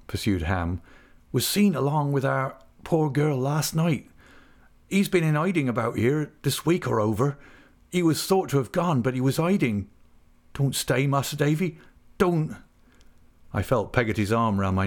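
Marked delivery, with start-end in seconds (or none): 1.3-12.6 put-on higher-pitched rustic young man, reluctant; troubled countryman, pitched up; anxious Ham. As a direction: tell it anxiously in a country dialect. Narrator voices Ham.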